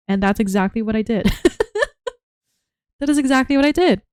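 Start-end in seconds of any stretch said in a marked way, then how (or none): 1.3-2.1 high laugh. Real, not acted. Laughing at own recording.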